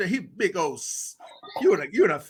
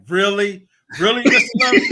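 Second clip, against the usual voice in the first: amazed teachers; 8 dB louder than usual. Retold or imitated. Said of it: "They be like".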